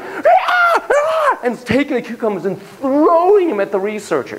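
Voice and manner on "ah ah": high shrieking ape cry; monkey shriek. Acted. Monkey B shrieking at the researcher, high shriek. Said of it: "Monkey B is like".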